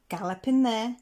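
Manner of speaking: ordinary delivery, nothing marked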